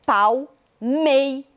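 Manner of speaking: Palmeiras broken slowly into pal, mei, ras; syllable by syllable. Shown as a demonstration. Teacher asking for the last word's stressed syllable, syllable by syllable. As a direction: say Palmeiras in separate, drawn-out syllables.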